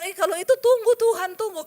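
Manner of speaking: high, loud "wait, wait"; alarmed protest. Retold or imitated. Begging God to hold off, high loud alarmed protest. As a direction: stop him before he goes too far.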